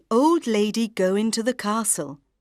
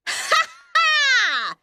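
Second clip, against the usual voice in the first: evil cackle. Acted. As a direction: cackle in triumph. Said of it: "she laughed".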